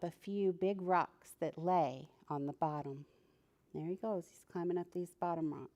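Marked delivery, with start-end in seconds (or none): none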